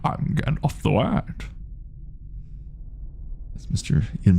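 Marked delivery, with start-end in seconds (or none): 0.0-1.2 high unvoiced wizard proclamation; whispered Gandalf. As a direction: reveal yourself in a hushed wizard voice.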